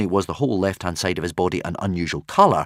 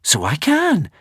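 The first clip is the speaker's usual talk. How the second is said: surprised; 9 semitones higher, 4 dB louder, slower than usual. A narrator's reading. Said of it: "said Hiccup in surprise".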